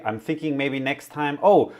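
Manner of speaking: normal talking voice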